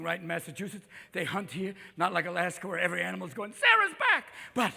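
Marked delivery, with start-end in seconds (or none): 3.5-4.2 high, loud mock warning cry; hammed-up panicked warning; alarm shout. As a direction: yell a comic alarm to the herd. Alaskan animals warn of the hunter.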